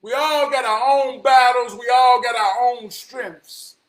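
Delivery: forceful rallying cry; impassioned proclamation. Real, not acted. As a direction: proclaim it to the whole crowd. Rousing declaration to the listeners.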